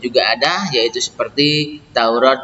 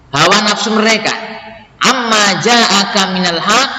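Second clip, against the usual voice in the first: verse reading; 6 semitones higher than usual. Acted. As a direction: read each phrase deliberately in a lifted voice. Reads verse with translation.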